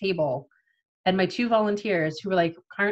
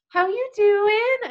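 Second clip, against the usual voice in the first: chirpy check-in; 12 semitones higher, 5 dB louder, much slower than usual. Retold or imitated. Staff checking in cheerfully.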